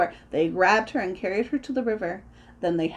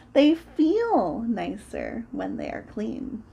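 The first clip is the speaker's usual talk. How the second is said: slow line leaning on "feel"; stress on feel; 3 semitones higher, slower than usual. Acted. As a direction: savour "feel" as the discovery. Third discovery, feel is stressed.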